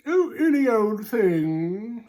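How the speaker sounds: high, slow, airy dismissive voice; offhand big animals. Acted. Big animals brush off the rabbit's question, high and slow. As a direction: toss it off like it doesn't matter. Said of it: "said the big animals".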